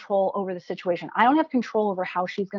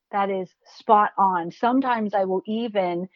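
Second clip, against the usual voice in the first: ordinary talk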